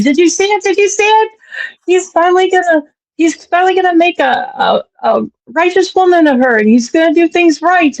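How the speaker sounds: high-pitched excited gushing; retold giddy excitement; all excited. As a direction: imitate a friend bursting with news. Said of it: "she's all excited".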